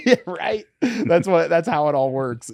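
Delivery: high, loud, through a laugh; laughing agreement. Real, not acted. Fighter jokes with host about wild rumors, laughing.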